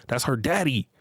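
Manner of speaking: ordinary talk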